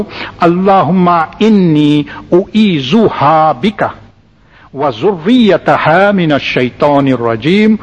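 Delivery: very slow recitation, "ha" in "u'izuha" held long; slow demonstration recitation. Shown as a demonstration. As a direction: stretch the "ha" of "u'izuha" and "zurriyataha". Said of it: "the ha for the female".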